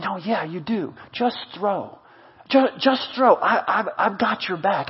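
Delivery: soft, reassuring encouragement; gentle insistence. Acted. Encourager answering someone full of doubt, soft and reassuring. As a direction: quietly coax them past their doubt.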